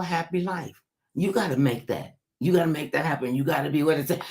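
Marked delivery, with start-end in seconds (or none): none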